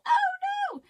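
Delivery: oh no. Real, not acted. Sudden mishap mid vlog.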